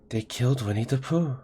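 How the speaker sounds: hushed, stunned disbelief; freaked out. Retold or imitated. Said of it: "and it's all freaked out".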